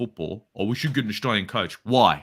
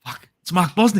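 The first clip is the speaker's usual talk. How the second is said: reliving meeting a hero, pitched up and louder; starstruck awe; 8 semitones higher, 7 dB louder than usual. Retold or imitated. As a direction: gasp out the name like a starstruck fan. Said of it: "I was in awe".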